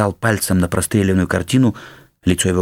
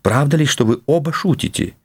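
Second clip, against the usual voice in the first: higher-pitched, lightly voiced worried woman; anxious countess; 7 semitones higher than usual. Countess asking Silvio if both are joking, anxious. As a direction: plead lightly for an answer, pitched up. Frightened wife questions Silvio.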